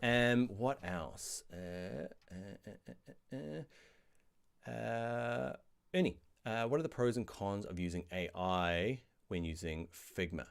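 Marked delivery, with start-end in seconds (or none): none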